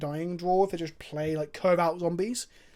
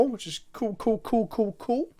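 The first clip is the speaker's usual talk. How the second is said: rising sarcastic cool cool cool, climbing higher each time; 5 semitones higher, slower than usual. Real, not acted. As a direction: shrug it off with mock cheer. Sarcastic reaction to bad draw.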